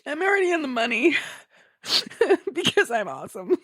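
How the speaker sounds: very high, laughing and teary; high laughing brag. Retold or imitated. Crying gamer bragging to herself, high laughing. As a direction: brag through laughter and tears.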